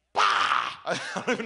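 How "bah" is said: sudden outburst. Retold or imitated. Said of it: "I'm like".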